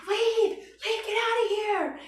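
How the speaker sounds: high-pitched mock holler; imitated yelling. Man going wild at the speaker, high imitated yelling. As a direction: holler it high, warding someone off.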